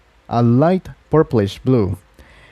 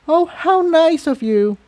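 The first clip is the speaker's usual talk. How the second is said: put-on much higher, smiling young mare; with a smile. A narrator's reading. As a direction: thank her with a smile in the voice. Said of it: "Aurora said with a smile".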